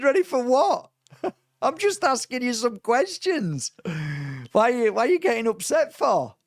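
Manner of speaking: high, loud, half-laughing disbelief; incredulous retort. Retold or imitated. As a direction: toss it back at him, laughing in disbelief. Arguing back at the guru.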